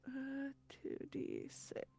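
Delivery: slow, barely-there whisper; whispering to self. Real, not acted. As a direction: whisper it slowly under your breath. Reads dice aloud to self.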